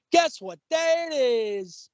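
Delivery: high, very drawn-out taunting call; commercial camel. Retold or imitated. Co-host imitating camel from a commercial, high drawn-out call. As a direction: sing it out slowly, stretching the end. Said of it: "hilarious commercial".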